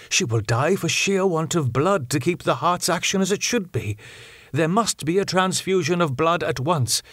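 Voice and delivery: Van Helsing, urgent. Acted. Professor tells doctor the patient needs blood, pressing old professor voice.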